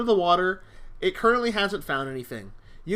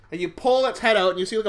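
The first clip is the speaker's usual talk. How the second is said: usual speaking voice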